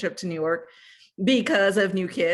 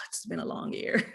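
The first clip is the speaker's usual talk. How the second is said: breathy, worn-out sigh of the words; weary sigh; 5 dB quieter than usual. Real, not acted. Telling the host about the pandemic, weary breathy sigh. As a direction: let it out on a tired breath.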